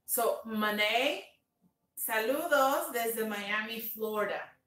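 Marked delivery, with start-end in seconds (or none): 0.4-1.2 "Monet" drawn out as ma-NAY, no t; slow English "Monet", O turned to a, rising end; English pronunciation. Shown as a demonstration. Host greets the viewer by name, English pronunciation. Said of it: "la O se pronuncia como la A".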